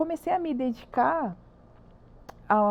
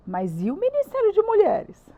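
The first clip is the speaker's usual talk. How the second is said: higher, louder, indignant question; indignant protest; 10 semitones higher, 6 dB louder than usual. Retold or imitated. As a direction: protest it loudly, pleading the case. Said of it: "E eu assim". (protesto indignado, mais agudo e mais alto)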